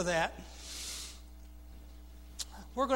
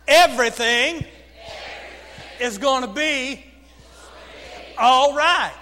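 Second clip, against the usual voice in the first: loud, broken into three separate phrases; repeat-after-me phrasing; 4 semitones higher, 9 dB louder than usual. Shown as a demonstration. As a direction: call it out phrase by phrase, pausing for echoes. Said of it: "repeat after me".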